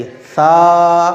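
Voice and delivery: सा with the tongue against the front teeth. Shown as a demonstration. Teacher demonstrating correct सा, tongue on teeth. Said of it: "ज़बान ... दांतों से लगाई थी".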